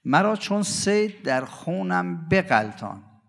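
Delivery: slow, drawn-out devotional verse; poem recitation. Acted. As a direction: chant each line slowly like a lament.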